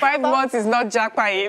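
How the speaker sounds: loud, high-pitched teasing objection; playful protest. Pretend. Co-host teasing auntie about five months of jackpying, playful protest. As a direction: protest loudly over the laughter.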